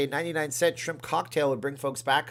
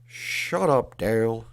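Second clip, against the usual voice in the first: loud, drawn-out cartoon Texan dad; Hank Hill; 5 dB louder, much slower than usual. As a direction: snap it in a hammed-up Texan voice, loudly. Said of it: "Can you say".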